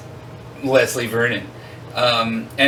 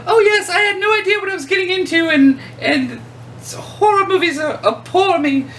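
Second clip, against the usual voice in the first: much higher prim British grandmother voice; a sweet old British lady, pitched way up; British accent; 4 dB louder than usual. Retold or imitated. Retelling the British actress talking about horror films, in a high, prim British accent. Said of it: "what struck me is Barbara's British".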